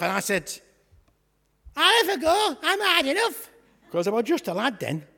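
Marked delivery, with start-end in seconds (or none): 1.8-3.5 put-on higher, boyish cocky reply; boy's unbroken voice. Young David answering Goliath's dare, higher boyish cheek. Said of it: "my voice hadn't broke proper".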